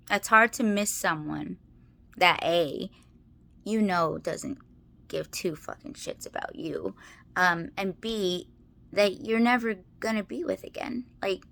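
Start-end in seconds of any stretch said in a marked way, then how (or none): 4.2-6.9 whispered curse. Real, not acted. Bitter aside about her ex.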